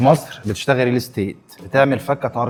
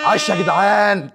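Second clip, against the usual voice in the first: higher, slow victory praise; excited cheer; 7 semitones higher, slower than usual. Real, not acted. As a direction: cheer the team on. Celebrating the win. (تهليل بطيء بصوت عالي)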